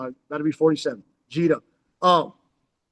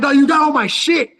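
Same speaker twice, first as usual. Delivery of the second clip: higher and louder, stunned at seeing his homeboy; startled outburst; 6 semitones higher, 6 dB louder than usual. Retold or imitated. Spotting his friend Mike in the cell, a loud startled outburst. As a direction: blurt it out in disbelief. I'm thinking in my mind.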